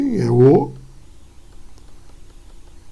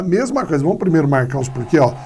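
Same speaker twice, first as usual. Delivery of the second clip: usual speaking voice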